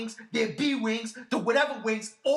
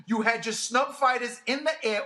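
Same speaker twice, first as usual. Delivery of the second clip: normal talking voice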